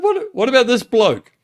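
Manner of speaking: high, loud mock-indignant Peter. Peter protesting to Jesus about the other disciple, high and indignant. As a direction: squeal it like a put-out disciple. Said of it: "Peter ... turns around and said".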